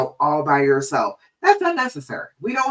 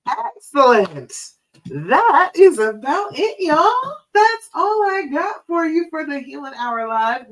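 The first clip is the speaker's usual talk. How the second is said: upbeat sign-off; 8 semitones higher, 4 dB louder than usual. Real, not acted. Host cheerfully closing the Healing Hour Live for viewers, upbeat. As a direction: wrap up the show brightly.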